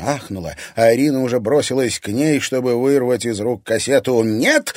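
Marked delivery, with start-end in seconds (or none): 4.2-4.7 short raised cry of refusal; defiant cry. A narrator's reading. Anfisa fighting off Irina for the cassette, defiant cry. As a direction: cry it out higher while fending someone off. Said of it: "крикнула та, отбиваясь".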